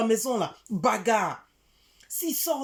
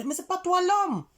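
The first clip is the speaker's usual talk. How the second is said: high, loud reproach; acted-out indignant outburst; indignant scolding; 10 semitones higher, 5 dB louder than usual. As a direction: put the friend in her place, voice raised high. Acting out scolding her friend.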